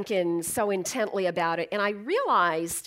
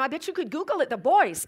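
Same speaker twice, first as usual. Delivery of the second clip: usual speaking voice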